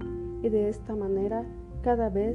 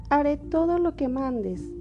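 put-on lightly raised girl's voice, slow; a sweet young girl promising; little girl voice; 6 semitones higher, slower than usual. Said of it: "prometió Caperucita". (voz de niña)

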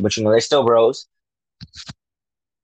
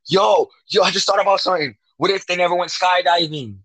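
high-pitched, excited burst of a new idea; real sudden excitement; 8 semitones higher than usual. Real, not acted. Fan interrupting his own theory with a sudden idea, excited. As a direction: blurt out a brainwave, pitched way up.

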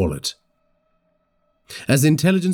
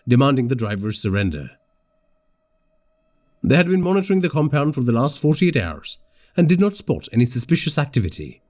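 ordinary delivery, nothing marked

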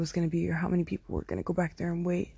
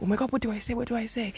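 higher-pitched, flustered pleading; mock panic; 5 semitones higher, faster than usual. Asking my friend what to text back, panicky. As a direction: act out frantically asking for help.

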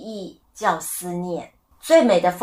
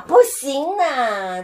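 shocked protest; 7 semitones higher, 3 dB louder, much slower than usual. Real, not acted. Objects to a harsh saying.

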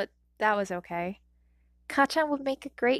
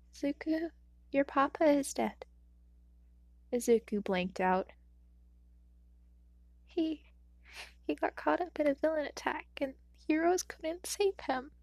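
grieving mother; 5 semitones higher than usual. A narrator's reading. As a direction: tell him through held-back tears. Mother telling death.

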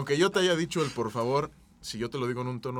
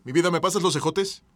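louder, pushier request; louder demanding ask; 4 semitones higher, 6 dB louder than usual. Shown as a demonstration. Demanding green beans from partner, louder and pushy. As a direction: bark the request at your partner. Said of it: "no es lo mismo que".

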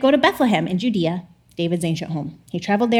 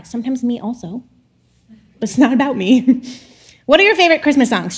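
usual speaking voice

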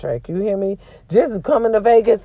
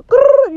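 short frustrated growl, loud and high; 8 dB louder than usual. Real, not acted. Venting to viewers with a frustrated growl. Frustrated aside mid-rant.

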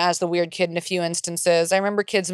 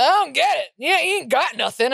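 high, exaggerated baffled dad; hammed-up pitched-up dad; imitated dad; 9 semitones higher, 3 dB louder than usual. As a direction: play a father protesting in put-on disbelief. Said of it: "And he's like".

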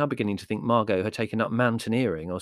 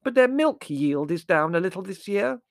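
loud, high oblivious interjection; booming high aunt voice; hard-of-hearing aunt; 11 semitones higher, 6 dB louder than usual. A narrator's reading. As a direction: chime in loudly as if deaf. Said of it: "said Aunt Fan".

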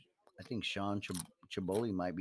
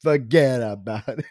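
slow hammed-up Italian "Forget about it"; mock mobster accent; 6 dB louder, slower than usual. Friend joining in with a mobster accent. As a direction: drawl the catchphrase like a wiseguy. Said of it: "your accent".

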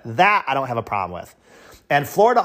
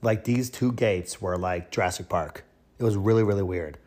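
ordinary talk

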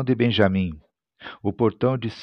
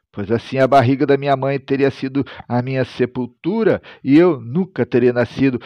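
ordinary talk, nothing marked

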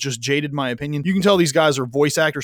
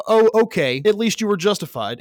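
mock switch to placid, higher and louder; sudden calm; 6 semitones higher, 3 dB louder than usual. Cop suddenly forgiving his girlfriend, hammed-up calm. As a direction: flip instantly from mad to okay. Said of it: "went from really mad to".

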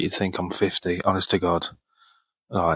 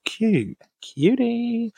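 much higher, sing-song coo; cooing cutie; much slower than usual. Real, not acted. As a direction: coo over a sweet fan. Touched by fan's message.

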